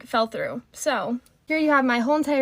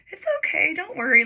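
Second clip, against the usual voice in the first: put-on higher, gently soothing boyfriend voice; imitating reassuring boyfriend; 8 semitones higher, 4 dB louder than usual. Boyfriend calming his crying girlfriend, higher soothing voice. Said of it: "he was just like".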